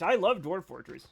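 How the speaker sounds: usual speaking voice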